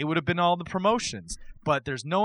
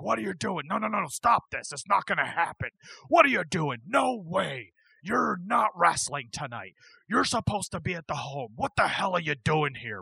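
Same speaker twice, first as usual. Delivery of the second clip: pissed-off Vince; 3 semitones higher than usual. Retold or imitated. Boss storms out at a wrestler he didn't book, mock pissed-off protest. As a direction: imitate an angry boss putting a stop to it. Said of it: "He's pissed".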